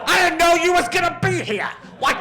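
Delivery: put-on high, shouted American accent, all surprise. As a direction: yell it in a hammed-up American accent. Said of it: "are you just doing the accent".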